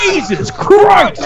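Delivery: slow, high, mock-shocked cry; drawn-out exclamation. Real, not acted. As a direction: stretch it out in disbelief.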